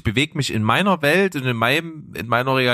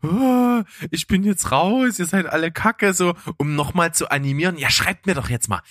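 hammy stage actor; 6 semitones higher than usual. Retold or imitated. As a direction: ham up the dramatic exit. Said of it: "Immer so dieses theatralische".

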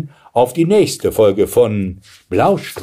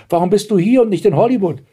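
high, quick astonished question; 10 semitones higher, faster than usual. A narrator's reading. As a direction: fire off the puzzled question. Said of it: "fragte Blaustrümpfchen erstaunt". (verwundert, hoch und schnell)

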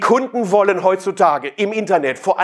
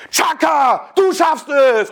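hammed-up motivational yell, pitched higher; mock trainer shout; 7 semitones higher, much slower than usual. Sales trainer roaring at the audience, hammed-up motivational shout. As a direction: roar it like a pumped-up sales coach. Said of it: "euch anbrüllen und sagen".

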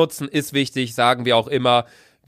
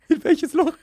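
laughing disbelief; 12 semitones higher than usual. Real, not acted. Confused, laughing at the story.

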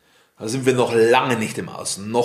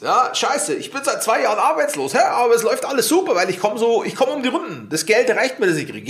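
pitched-up mocking voice of a man making excuses; mock jobless excuse maker; 8 semitones higher than usual. Speaker mocks a jobless man who says all is fine, in a high, mocking voice. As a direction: ham up a cheerful excuse, voice raised high.

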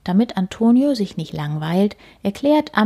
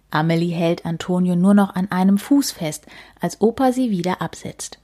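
plain reading voice

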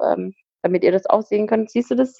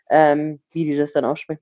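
usual speaking voice

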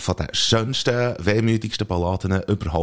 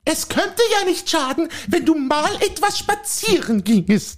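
a higher, fussy housewife voice pestering her husband; nagging wife; 3 dB louder, slower than usual. Acted. Wife nagging her husband to go for a walk, fussy. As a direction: play the nagging wife in a comic sketch. Acted dialogue sketch, wife role.

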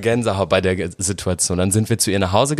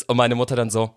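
usual speaking voice

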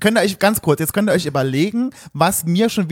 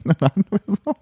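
high, giggling through the words; laughing; 7 semitones higher, slower than usual. Real, not acted. Laughing at the cashier joke with her friend. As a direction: laugh it out, amused.

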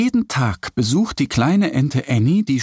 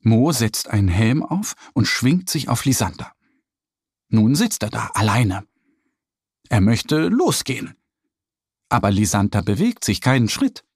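usual speaking voice

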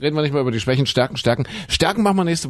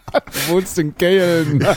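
laughing; 4 semitones higher, much slower than usual. Real, not acted. Teasing with a laugh.

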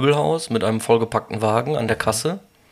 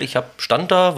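usual speaking voice

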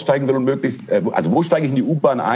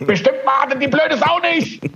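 much higher, indignant cry; exasperated outburst; 12 semitones higher than usual. Retold or imitated. Annoyed commuter cursing the U-Bahn, high exasperated cry. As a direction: shriek the curse at the train in disbelief.

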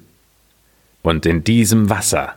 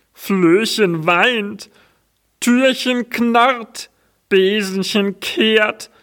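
lamenting dung heap; 12 semitones higher than usual. Acted. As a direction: wail the list like a burning heap. Dung heap lists the woes.

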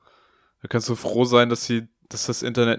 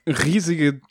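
usual speaking voice

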